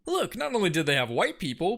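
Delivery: ordinary delivery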